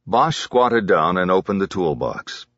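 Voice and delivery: ordinary delivery, nothing marked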